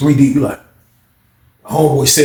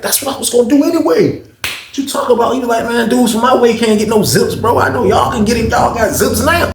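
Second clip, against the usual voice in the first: imitating the friend, pitched up; higher mock voice for the other guy; high voiced buddy; 9 semitones higher than usual. Friend voiced in the retold deal.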